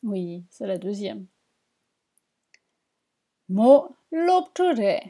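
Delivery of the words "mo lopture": lent et appuyé. Shown as a demonstration. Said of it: "à quelle phrase va quelle image".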